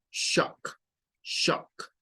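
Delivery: shock said as shok; shock, open o then k; English shock. Shown as a demonstration. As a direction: shock with a rounded o. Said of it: "esto se pronuncia como".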